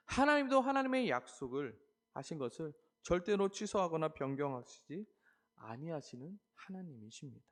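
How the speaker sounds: normal talking voice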